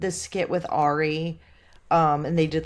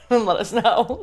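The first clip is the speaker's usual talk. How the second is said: laughing it out brightly, higher and louder; giggly, higher pitched joking offer; 6 semitones higher, 4 dB louder, slower than usual. Real, not acted. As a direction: crack up mid-invite. Joking invite to Ashley.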